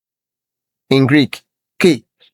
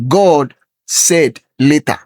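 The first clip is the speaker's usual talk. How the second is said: normal talking voice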